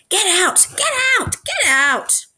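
high, rising furious screams; furious shouting. A narrator's reading. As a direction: shove her sister out yelling. Clancy shoves sister out.

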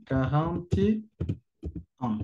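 "quarante et un" muy lento, con pausas entre palabras; slow demo pronunciation. Shown as a demonstration. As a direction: alarga cada palabra de quarante et un. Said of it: "la prononciation". (despacio, como modelo de pronunciación)

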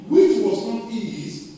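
ordinary delivery

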